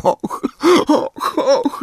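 broken sobbing gasps rising high; a mother sobbing into her hands; tearful oh oh oh; sobbing och. Acted. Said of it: "zaszlochała pani Wesley".

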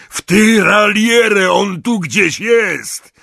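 loud, high, slow order to the squad; commanding shout. Acted. Death Eater orders his companions to fan out, shouting. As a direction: bark the order to spread out. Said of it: "zawołał do swoich towarzyszy".

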